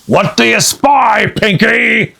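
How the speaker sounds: loud pirate shout; Captain Bones shouting to Pinky. Acted. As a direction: call up the ladder. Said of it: "shouted Captain Bones".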